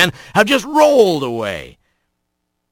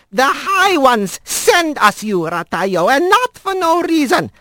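put-on old squirrel grandmother; 12 semitones higher than usual. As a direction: coax him like a fond old granny. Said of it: "and said in Manx".

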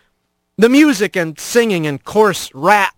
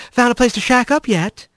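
higher, suggestive come-on; flirty questioning; 5 semitones higher than usual. A narrator's reading. Laris asking where the new rat sleeps, flirting. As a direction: lilt it up as a teasing invitation. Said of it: "and her questions like".

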